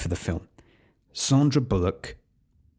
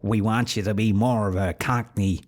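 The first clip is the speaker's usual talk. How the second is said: badly faked American accent of a pushy director; shaky amateur American accent, urging an actor on; attempted American accent. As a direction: put on a clumsy American accent, insisting. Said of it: "meant to be a American accent".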